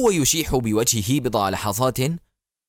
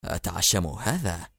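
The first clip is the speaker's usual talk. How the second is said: low, soft, slow doubtful murmur; tense mutter; 7 semitones lower, 6 dB quieter than usual. Acted. Pilot muttering doubtfully to Barak, low and slow. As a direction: mutter it uneasily under the breath. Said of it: "هز الطيار كتفيه في توتر مغمغما".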